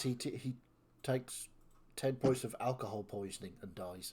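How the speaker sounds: ordinary delivery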